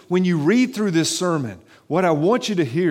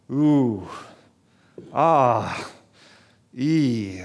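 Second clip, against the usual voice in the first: ooh, ah, eh pulled apart like a slow moan of grief; grieving sound syllables; 2 semitones lower, much slower than usual. Shown as a demonstration. Pastor breaking the word into syllables to show it voices grief. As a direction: sound out each vowel as a sigh of sorrow. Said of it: "it's an expression of grief".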